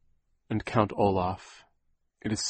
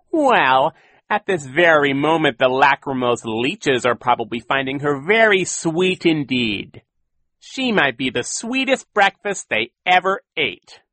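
high, loud, gleeful villain; Count Olaf voice; 6 semitones higher, 9 dB louder than usual. A narrator's reading. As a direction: relish the cruel joke. Olaf gloating.

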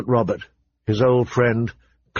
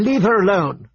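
angry shout; 9 semitones higher than usual. A narrator's reading. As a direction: shout it back in anger. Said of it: "Arya shouted angrily".

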